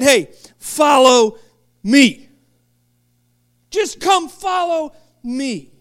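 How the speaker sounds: slow, earnest invitation; Jesus inviting the sinner, slow and earnest; inviting call. Acted. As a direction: invite him in, drawn out. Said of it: "He looked at the known sinner and he said".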